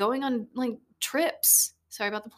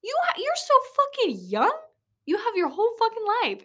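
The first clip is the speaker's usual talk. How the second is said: rising to a high hammed-up pep shout; mock motivational push, climbing higher; imitating Gary Vee; 9 semitones higher, 4 dB louder than usual. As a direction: hype speaker getting loud and high. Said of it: "He's like".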